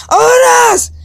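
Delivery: grito agudo y fuerte de hartazgo; grito exasperado. Pretend. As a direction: suelta de golpe todo el fastidio de horas perdido. Estallido tras quejarse de vagar. (grito exasperado, agudo y fuerte)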